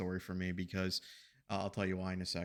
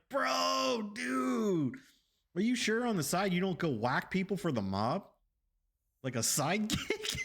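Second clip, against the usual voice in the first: much higher, giggly teasing voice; high laughing banter; amused mock question; 10 semitones higher than usual. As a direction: tease him like a mob sidekick, laughing. Retold reply, laughing.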